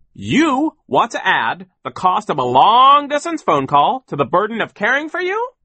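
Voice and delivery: loud, high, incredulous question; blustering affronted demand; outraged boss. A narrator's reading. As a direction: stretch it out with indignant disbelief. Sir outraged at Violet's request.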